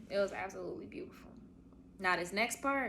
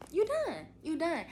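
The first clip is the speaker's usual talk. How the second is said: much higher and louder, triumphant; gleeful you done; 9 semitones higher, 3 dB louder than usual. Real, not acted. Telling new moms the hard part is over, triumphantly. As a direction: cheer it like the finish line.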